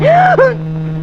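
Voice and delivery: sharp high gasp of a woman jolted; shocked gasp. Acted. Wife jolted by the hair dryer, sharp high gasp.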